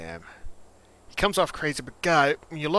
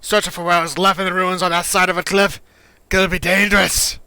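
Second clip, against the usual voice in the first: loud, higher-pitched game character; Echo; 8 semitones higher, 13 dB louder than usual. Acted. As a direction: push it out loud and high, full of bluster.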